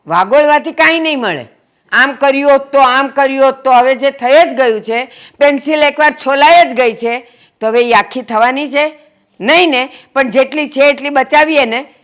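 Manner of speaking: loud, high, urgent reasoning; forceful exhortation. Acted. She cuts short the family quarrel. (ઊંચા અવાજે ભારપૂર્વક સમજાવટ)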